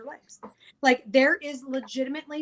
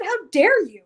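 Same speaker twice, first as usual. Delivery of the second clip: higher, louder mock outrage; indignant retort; 8 semitones higher, 10 dB louder, slower than usual. Wife retelling how she scolded her husband. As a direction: hurl it at him offended.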